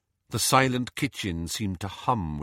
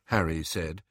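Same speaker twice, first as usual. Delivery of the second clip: usual speaking voice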